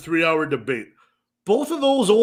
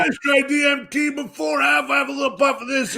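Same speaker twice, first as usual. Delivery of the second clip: imitating Joe Rogan; 8 semitones higher than usual. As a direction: ham up a laid-back stoner host offering drugs. Impersonating Joe Rogan offering DMT.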